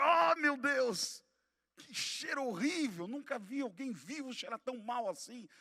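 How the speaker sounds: loud, drawn-out "oh my God", then high dismay; appalled exclamation. Retold or imitated. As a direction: recount your horror at a stench. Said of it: "eu falei".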